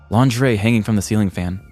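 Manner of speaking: normal talking voice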